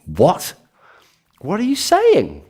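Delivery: high, slow incredulous question; high-pitched disbelief; baffled. Acted. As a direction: sound completely baffled. Incredulous reaction to strange name.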